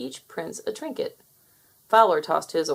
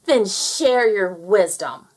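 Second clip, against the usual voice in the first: slow, loud impatient demand; sneering brother demanding the answer; 7 dB louder, slower than usual. Acted. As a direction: make him spill it. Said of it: "Fowler demanded".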